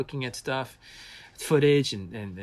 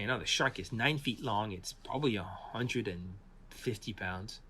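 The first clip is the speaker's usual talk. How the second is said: ordinary talk, nothing marked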